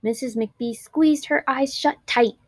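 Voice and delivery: plain reading voice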